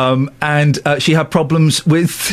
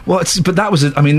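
ordinary talk

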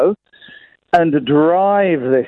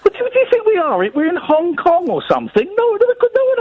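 incredulous; 11 semitones higher than usual. Acted. Mocking a silly guess.

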